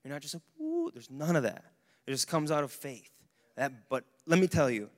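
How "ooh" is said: high gushy ooh; mock swoon. Preacher mocking the feeling people expect, high ooh. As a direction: swoon in parody.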